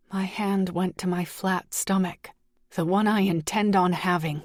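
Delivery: ordinary delivery, nothing marked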